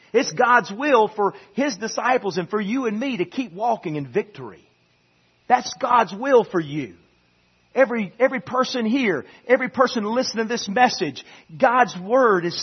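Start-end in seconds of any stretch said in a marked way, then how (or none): none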